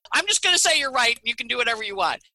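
high, fast, throwing up her hands in surrender; imitating a friend who caves, rushing the end; mock resigned giving in. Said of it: "That's her posture".

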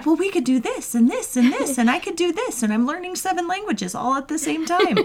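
high, eager rush of ideas; overexcited planner. Retold or imitated. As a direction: pile on plan after plan. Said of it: "'Cause I'm like".

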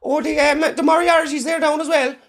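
high-pitched gossip; nosy local voice. Pretend. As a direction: gossip like a village busybody.

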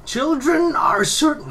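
high, slow, theatrical proclamation; high dramatic reading. Shown as a demonstration. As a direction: declaim it high and grand. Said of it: "No, wait, no, I'm not gonna do that".

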